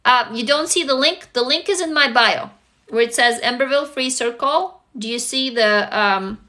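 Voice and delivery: ordinary talk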